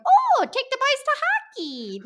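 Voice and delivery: mock Fargo Minnesota voice; Minnesota accent. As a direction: Minnesota accent again, big and silly.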